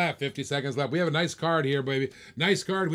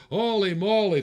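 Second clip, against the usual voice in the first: ordinary talk, nothing marked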